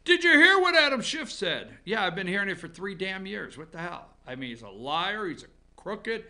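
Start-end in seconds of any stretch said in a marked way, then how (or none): 0.0-1.8 higher, louder mock excitement; mocking eager gossip. Mocking what everyone says on Monday, higher and louder. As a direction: parrot the breathless gossip, high and loud. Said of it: "everybody wants to come up on Monday and say".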